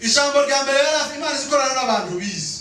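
high, forceful sermon delivery; full-force preaching. Real, not acted. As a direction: call it out to the congregation.

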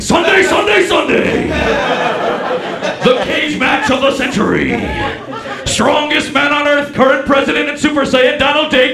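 monster truck promo, all caps; wrestling announcer. Acted. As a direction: Trump campaign email read as a wrestling hype promo.

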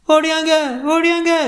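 very high-pitched wail; acted woman's alarmed shriek, held high; screaming for help. A narrator's reading. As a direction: scream out for people to come running. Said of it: "என்று அவள் அலறியதை".

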